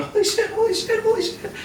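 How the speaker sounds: mock panic, high and breathless; panicked inner voice. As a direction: squeak it out like you're terrified. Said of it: "There's a side of you going".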